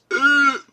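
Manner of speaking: sharp high loud groan of disgust; disgusted ugh. Retold or imitated. Reacting to fourteen dollar beef, loud high disgusted ugh. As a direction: recoil at the price with an ugh. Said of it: "I went".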